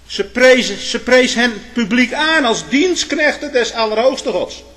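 high, loud pulpit declamation; full-force preaching. Real, not acted. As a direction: build to a loud proclamation.

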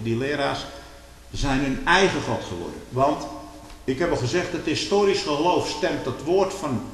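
loud, high, rising sermon fervour; fiery preaching. Acted. Preacher warning his congregation, preaching at full force. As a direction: thunder it from the pulpit.

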